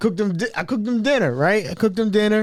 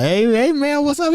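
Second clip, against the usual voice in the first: higher, jittery mock hello; nervous wide-eyed greeting; 12 semitones higher than usual. Greeting Mel while hiding the setup, mock nervous hello. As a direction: act innocent but edgy. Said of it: "I greet Mel wide eye".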